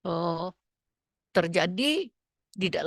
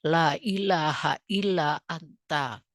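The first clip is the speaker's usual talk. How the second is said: recited Arabic prayer; 3 semitones lower, slower than usual. Shown as a demonstration.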